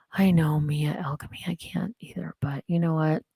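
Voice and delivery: soft secret whisper. Real, not acted. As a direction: whisper it to the chat. Whispered aside to viewer.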